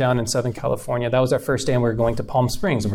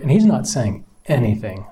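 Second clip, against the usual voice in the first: normal talking voice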